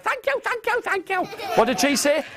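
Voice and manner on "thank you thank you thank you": very high, soft squeaky thanks; tiny fairy voice. Acted. The fairy queen thanks Stan, very high and small. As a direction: sound tiny and grateful. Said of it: "She said".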